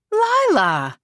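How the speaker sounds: high, loud posh exclamation; posh cry. Acted. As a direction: call her name out, posh and delighted. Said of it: "Lady Helena cries in her posh accent".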